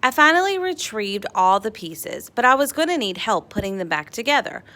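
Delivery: plain reading voice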